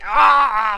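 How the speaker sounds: loud sharp cry of alarm; startled yell. Pretend. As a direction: yelp as you dodge back. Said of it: "hear the".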